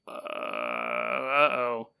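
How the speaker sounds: long, low, hesitant uh-oh; mock dread. Hermit hearing the door open again, mock dread. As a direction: draw it out softly, as if caught.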